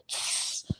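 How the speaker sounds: imitated air brake hiss, unvoiced. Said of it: "make the air brake sound".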